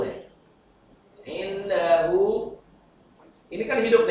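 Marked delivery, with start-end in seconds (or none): 1.2-2.6 "Indahu" with the final hu drawn out slowly; long hu. Shown as a demonstration. Teacher models mad sila qasira, "Indahu" with long final hu. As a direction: stretch the "hu" of "Indahu" to two beats. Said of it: "Dibaca panjang".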